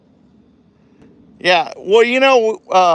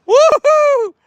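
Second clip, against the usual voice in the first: loud, high-pitched woo-hoo; high cheering holler; excited whoop; 7 dB louder than usual. Real, not acted. Cheering nephew's stunt.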